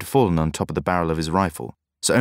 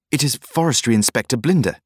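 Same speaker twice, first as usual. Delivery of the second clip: shocked cry; 6 semitones higher, 3 dB louder than usual. A narrator's reading. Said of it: "I heard him cry".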